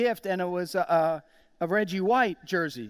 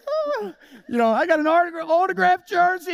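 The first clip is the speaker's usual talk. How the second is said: very high, loud delighted gasp; thrilled; 8 semitones higher, 4 dB louder than usual. Retold or imitated. Fan showing off a signed jersey, squealing high. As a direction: burst out like a kid at Christmas.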